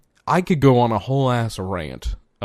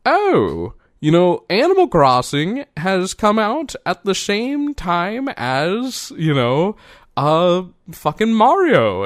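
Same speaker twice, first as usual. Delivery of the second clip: high, eager quoting of own past self, a bit louder; retold thought; 8 semitones higher, 4 dB louder, slower than usual. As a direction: replay your naive excitement. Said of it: "I was like".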